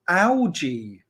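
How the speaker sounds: "algae" with a soft g, as AL-jee. Shown as a demonstration. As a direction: say "algae" with a j sound. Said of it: "I've just called it algae".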